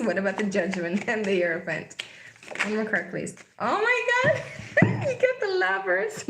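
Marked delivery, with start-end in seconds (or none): 3.6-6.3 very high squeal breaking into laughter; real delighted laughter. Real, not acted. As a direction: squeal through laughter at the card. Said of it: "Now I'm laughing".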